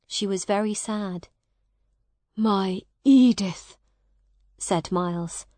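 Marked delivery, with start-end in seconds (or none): 2.4-3.8 louder, longing exclamation; heartfelt greeting. Acted. Miles sees Edith after years, heartfelt. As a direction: call to your lost love.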